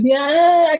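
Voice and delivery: loud, drawn-out "yeah" shoved out on too much breath; strained push. Shown as a demonstration. Singer showing how pushed breath sounds, strained cry. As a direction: push the sound out hard and long.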